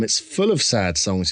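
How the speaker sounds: normal talking voice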